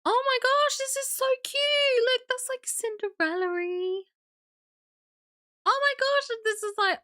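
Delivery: high-pitched delighted squeal; real excited gushing. Real, not acted. Streamer seeing cute pictures from a viewer, excited gushing.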